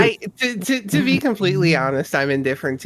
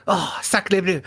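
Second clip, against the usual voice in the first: put-on French accent; 2 semitones higher than usual. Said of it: "would you prefer that I, like, gasp in French instead?".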